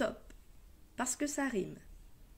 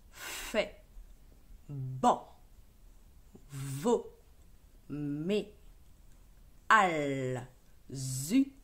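syllabes détachées; 6 semitones lower, much slower than usual. Shown as a demonstration. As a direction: read each syllable alone, clearly, with a pause after each. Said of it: "Deuxième ligne". (syllabes lues une à une, bien détachées)

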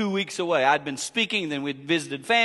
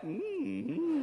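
knowing mm-hmm; 10 semitones higher, 10 dB quieter than usual. Retold or imitated. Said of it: "she looked over at me like".

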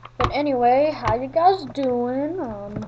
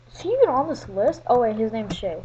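ordinary talk

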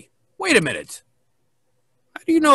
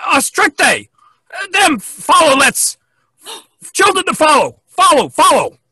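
shouting; 7 semitones higher, 7 dB louder than usual. Acted. Pirate shouting orders, backwards.

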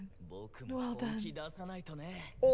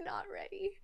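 whisper; 7 semitones higher, 13 dB quieter than usual. Real, not acted. Repeats it laughing softly.

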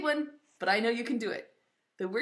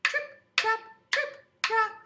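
high, short rhythmic hoof beats; hoof sounds; 9 semitones higher than usual. Shown as a demonstration. Storyteller making little goat's hoofs go, high. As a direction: chant each step high and crisp. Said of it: "make it go like this".

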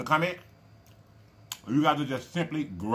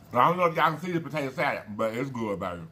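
usual speaking voice

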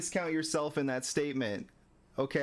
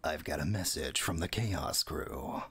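David Hayter voice; 9 semitones lower than usual. Retold or imitated. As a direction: lower Solid Snake imitation. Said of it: "yours is better though".

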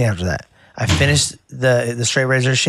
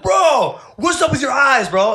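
startled disbelief; 13 semitones higher than usual. Retold or imitated. Asks the creepy client about eyes.

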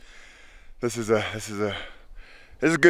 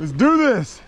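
excited shout; 12 semitones higher, 4 dB louder than usual. Real, not acted. Hiker psyching up on trail.

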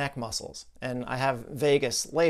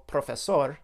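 "profesor" in a Spanish accent, round o in pro, full sor; "profesor" said in a best-effort Spanish accent. Shown as a demonstration. Demonstrating the patient's word, Spanish accent. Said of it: "in my best Spanish accent".

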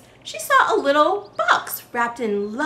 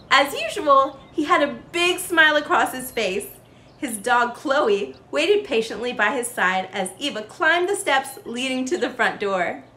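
usual speaking voice

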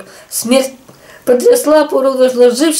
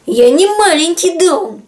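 higher, slow, offended protest; 6 semitones higher, slower than usual. A narrator's reading. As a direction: insist it back with a pout. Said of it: "обиделся ёжик".

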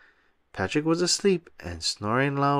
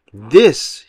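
excited; 9 dB louder than usual. A narrator's reading. Excited reveal of treasure.

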